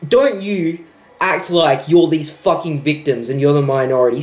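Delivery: ordinary talk, nothing marked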